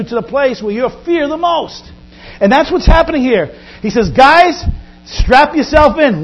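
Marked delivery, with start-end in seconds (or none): 4.1-6.2 loud, slow call to the disciples; rally the crew loudly, slowly; Jesus calling out. Acted. Jesus to disciples before sailing: loud, slow command.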